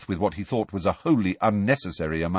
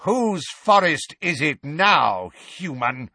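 slow, louder, mocking bellow; 6 semitones higher, 3 dB louder, slower than usual. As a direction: taunt in a bellow. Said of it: "bellowed Bane".

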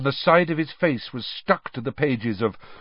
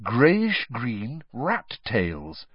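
ordinary delivery, nothing marked

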